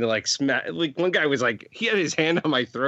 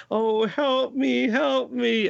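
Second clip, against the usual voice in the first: much higher, slow, pleading cry for help; a trapped girl begging in a padded room, stretched out high; acted damsel in distress; 11 semitones higher, much slower than usual. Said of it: "so she was acting".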